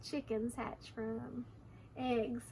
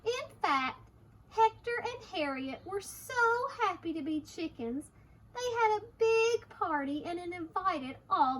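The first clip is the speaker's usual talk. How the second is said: put-on lilting, deliberate reading to children; storybook narration; 4 semitones higher, 4 dB louder than usual. A narrator's reading. Reader tells children of the chickens' party, lilting narration. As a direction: relish each phrase, swinging pitch, stretched words.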